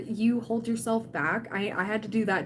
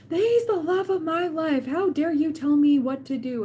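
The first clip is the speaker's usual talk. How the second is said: mock outrage; 7 semitones higher, 5 dB louder than usual. Streamer mimicking an angry commenter, mock outrage. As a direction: ham up an offended fan.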